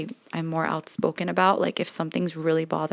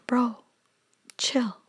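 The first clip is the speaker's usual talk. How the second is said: whispering; 6 semitones higher than usual. Retold or imitated. Voicing what she tells complainers.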